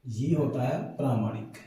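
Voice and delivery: ordinary talk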